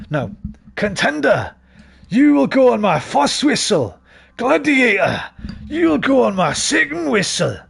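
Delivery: high, loud bad Scottish accent; Scottish referee impression. Imitating the Gladiators referee John Anderson, high loud bad Scottish accent. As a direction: call the start like the Scottish referee. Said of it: "I can't do Scottish accents".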